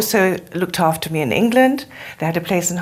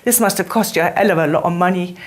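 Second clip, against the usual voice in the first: quick imitated Cockney; Cockney accent; 3 semitones lower, faster than usual. Student marvels at her teacher's travel costs, imitated Cockney accent. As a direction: rattle it off like a Cockney Londoner. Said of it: "in her Cockney accent".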